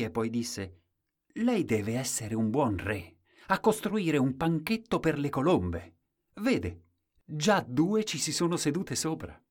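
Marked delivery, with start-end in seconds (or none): 1.4-9.4 a hint of an innocent little boy; timid boy. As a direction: pale small boy marvels to the king at the gallows. Timid boy speaks to king.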